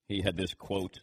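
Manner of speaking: normal talking voice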